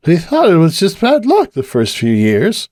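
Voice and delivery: a lighter voice for Cupcake musing on the lights; Cupcake's voice. Acted. Cupcake talking to bartender.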